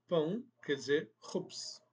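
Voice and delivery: usual speaking voice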